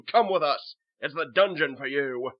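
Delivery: loud, commanding order; forceful guard's shout; stern guard. Acted. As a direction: order the prisoner away. Guard hauls prisoner away.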